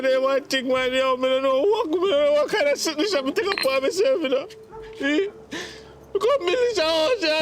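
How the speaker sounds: crying complaint, rising in pitch; distraught, weeping son lamenting; tearful breakdown. Acted. Son breaks down over money.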